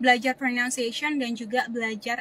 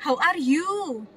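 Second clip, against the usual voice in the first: put-on thick Javanese-accented English, slow, higher and louder; medok Jawa; 4 semitones higher, 5 dB louder, much slower than usual. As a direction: drag it out in a heavy Javanese accent. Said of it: "bener-bener medok Jawa". (logat Jawa medok, pelan dan keras)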